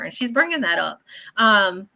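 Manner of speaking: ordinary talk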